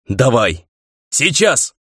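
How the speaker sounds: short urgent orders, rising in pitch on the second; command. A narrator's reading. Ray orders the gymnast to jump, commanding. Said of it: "и велел".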